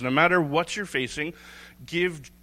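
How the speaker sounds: ordinary delivery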